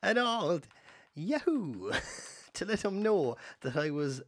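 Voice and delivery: quoting the sketch, breaking into laughter; Mossy Flood impression. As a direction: belt out the yahoo line, laughing through it. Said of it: "Look it up, Mossy Flood".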